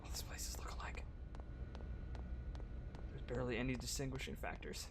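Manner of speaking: whispering. Real, not acted. Dim maze, hushed voice.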